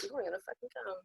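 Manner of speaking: quiet, dry mock scold; low teasing jab. Guest imitating Alicia's teasing line, quiet and dry. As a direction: say it low like a friend ribbing you. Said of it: "she would say it that way too".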